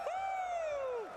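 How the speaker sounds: high, whooping crowd cheer; excited cheer. Real, not acted. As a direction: whoop it up for the fans. Host hyping crowd at event.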